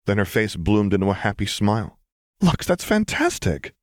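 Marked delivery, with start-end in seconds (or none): 2.4-3.8 high-pitched, delighted congratulation; bright-spirited, high, cheery reaction; cheerful. A narrator's reading. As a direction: burst out happily for a friend. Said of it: "she said cheerfully".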